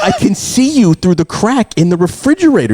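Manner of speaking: high, loud exasperated accusation; mock outrage with a laugh in it; retold arguing. Parent catches son at the fridge, arguing. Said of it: "I'm arguing with him".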